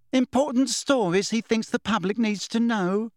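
sneering mocking repeat; withering. A narrator's reading. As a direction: throw the phrase back with scorn. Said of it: "she said witheringly".

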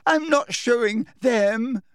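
slow, high, shrill refusal. A narrator's reading. Hermione refusing before the Slytherins, shrill. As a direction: dig in shrilly. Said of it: "said Hermione shrilly".

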